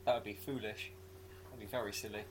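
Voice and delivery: usual speaking voice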